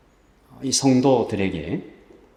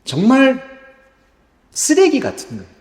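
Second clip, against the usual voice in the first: high, scornful cry of disgust; contemptuous outburst; 10 semitones higher than usual. Acted. Preacher voicing Moses' disgust at the people, scornful high cry. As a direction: spit out the contempt, pitched high.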